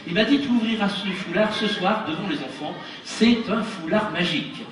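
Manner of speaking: put-on lively storytelling to children; kids show host. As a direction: spin a tale for the kids.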